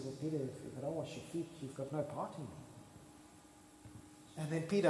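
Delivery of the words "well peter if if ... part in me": Jesus gently explaining. Retold or imitated. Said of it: "Jesus looks at him and says".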